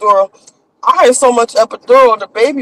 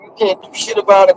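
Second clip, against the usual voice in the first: ordinary talk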